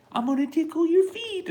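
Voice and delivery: put-on very high, loud cooing baby talk, rising to a squeal; mom baby talk. Video mom cooing to her baby in the sarcophagus. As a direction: gush at the baby like a doting mom. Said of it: "You can even have her like".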